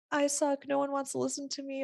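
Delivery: mock a small inner voice of self-doubt; lightly voiced inner critic. Voices own self-doubting thought.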